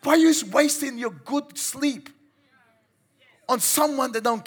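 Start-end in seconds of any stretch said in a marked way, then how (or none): none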